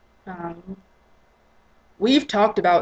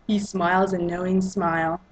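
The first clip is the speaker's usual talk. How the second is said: usual speaking voice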